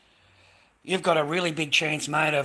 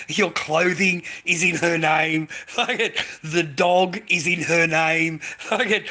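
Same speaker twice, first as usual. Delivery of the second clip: laughing; 4 semitones higher, 7 dB louder, slower than usual. Real, not acted. Laughing at own absurd list.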